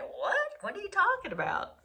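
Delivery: puzzled. Retold or imitated. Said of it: "they're gonna be looking at you like".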